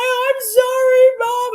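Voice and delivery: imitating crying child. Son apologizing to Mum after the popper bang, mock crying wail. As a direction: wail it like a sobbing little boy, hammed-up. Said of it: "So he's crying".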